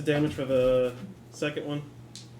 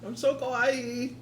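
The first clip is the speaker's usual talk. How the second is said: excited anime fan; 6 semitones higher, 5 dB quieter than usual. Pretend. Cute anime voice.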